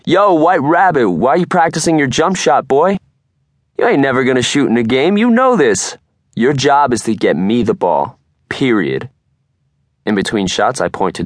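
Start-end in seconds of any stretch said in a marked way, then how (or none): 0.0-9.1 cocky jock heckling at full volume; teasing yell. Acted. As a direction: shout it out to ribbing the teammate. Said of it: "yells out from the pack of football players".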